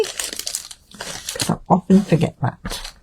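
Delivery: slow whispered aside; quiet aside. Real, not acted. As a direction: mutter it to yourself slowly. Aside to self.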